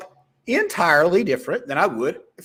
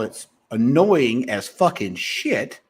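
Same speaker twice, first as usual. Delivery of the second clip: ordinary talk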